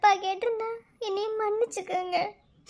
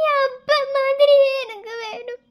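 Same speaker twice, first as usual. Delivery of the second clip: wailing sob, a little louder, almost sung; sobbing wish; 4 semitones higher, 6 dB louder than usual. Acted. Bride sobbing to her fiancé that she wants a son like her father, wailing. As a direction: let the longing spill out in a sob. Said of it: "இப்ப எதுக்கு அழுதுகிட்டு இருக்க?".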